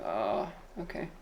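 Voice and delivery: very soft, low, deflated reply; meek mock acceptance. Streamer voices their reply to correcting viewers, small and meek. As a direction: shrink back quietly.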